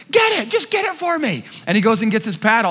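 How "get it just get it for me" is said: high, pushy plea; high urgent buddy; friend pleading. Retold or imitated. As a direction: beg him to grab it, pitched up. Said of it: "and he's like".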